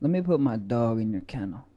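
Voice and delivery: mock smooth pickup line. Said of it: "You ready for this?".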